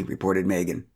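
usual speaking voice

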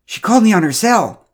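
high, loud, worried. A narrator's reading. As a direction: tell it with urgent worry. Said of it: "he explained worriedly".

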